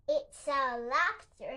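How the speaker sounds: usual speaking voice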